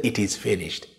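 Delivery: soft, satisfied after sweeping the house; content, job done. Shown as a demonstration. Preacher acting a housekeeper's soft, contented finish. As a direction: murmur it, the chore is done at last. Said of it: "you feel you have swept the house and it's all done".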